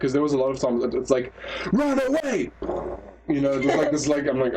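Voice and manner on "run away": much higher imitated yell; mock dub shout. Podcaster imitates dub voice drowning out explosion, mock shout. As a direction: yell it like an overloud dubbed warning. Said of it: "the voice would still be louder than the explosion".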